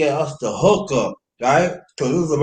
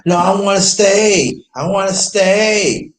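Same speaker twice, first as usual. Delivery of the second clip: higher, louder, stretched-out protest; insistent retold plea; 7 semitones higher, 4 dB louder, slower than usual. Arguing over leaving Miami, insistent whine to stay. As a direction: insist slowly with long drawn final words.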